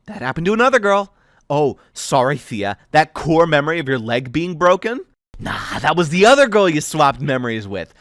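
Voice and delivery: lightly voiced girl, mock-cheerful and cutting; sarcastic mockery. Thea mocking Aiden's plan, sarcastic and bitter. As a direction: play it like a cruel game show host.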